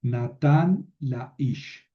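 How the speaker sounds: Natán la ish read slowly, each word apart; slow model reading. Shown as a demonstration. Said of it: "Por ejemplo". (lectura lenta y clara)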